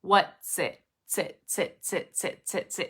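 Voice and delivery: "what sit" with T running into S; T-S connection. Shown as a demonstration. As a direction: drill "sit" as a repeated, short tsit. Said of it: "It's that T S connection".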